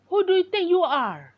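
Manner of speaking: bully turning on Izumi, shouting angrily; angry yell. Acted. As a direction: shout him down, outraged.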